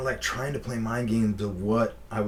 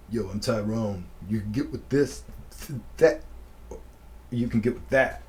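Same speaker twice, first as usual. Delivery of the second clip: mock rapper. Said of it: "he's like".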